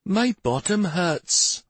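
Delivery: put-on high, slow child's voice; complaining child. A narrator's reading. Child sore from riding, complaining.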